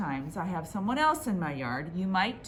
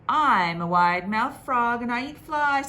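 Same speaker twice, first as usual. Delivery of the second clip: loud frog boast; 5 semitones higher, 8 dB louder than usual. Acted.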